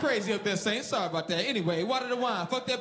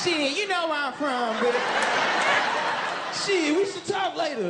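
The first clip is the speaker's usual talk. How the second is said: mock flirt; 7 semitones higher, 3 dB louder than usual. Acted party flirting quote.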